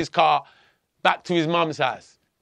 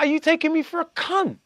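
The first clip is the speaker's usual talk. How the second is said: indignant disbelief; 10 semitones higher than usual. Retold or imitated. Retold indignant confrontation with policeman.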